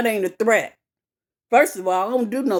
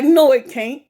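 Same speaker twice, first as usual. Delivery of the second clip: angry retort; 6 semitones higher than usual. Retold or imitated. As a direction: snap back at the officer. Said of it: "I was like".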